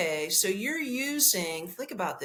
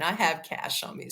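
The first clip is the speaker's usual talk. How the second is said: ordinary delivery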